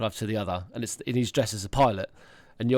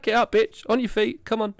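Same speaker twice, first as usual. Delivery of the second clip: mock tough order; 10 semitones higher, 4 dB louder than usual. Acted mock order to fallen child.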